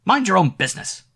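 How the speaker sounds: high, loud angry snap; sharp rebuke. A narrator's reading. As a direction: cut her off harshly. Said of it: "interrupted her mistress".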